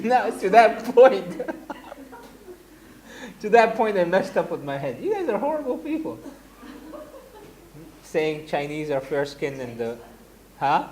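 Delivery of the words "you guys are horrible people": high playful scolding; lightly mock-scolding class; mock accusation. Real, not acted. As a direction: tease them as horrible, joking. Teasing class after the confusion.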